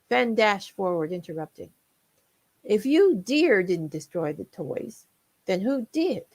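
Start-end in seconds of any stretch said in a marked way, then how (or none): none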